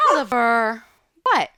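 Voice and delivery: sharp loud call to the dog. Real, not acted. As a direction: snap the name out loud to stop him. Yelling at her dog.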